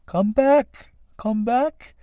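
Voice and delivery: high-pitched little snail voice; snail character. Acted. Second snail calling first back, high piping voice. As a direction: pipe it up high, drawn upward.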